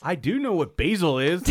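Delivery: high, loud flustered protest; laughing fluster. Real, not acted. Embarrassed friend defending his herb knowledge. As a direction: catch yourself mid-laugh. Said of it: "corrects himself".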